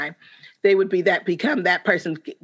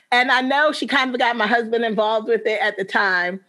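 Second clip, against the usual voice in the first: usual speaking voice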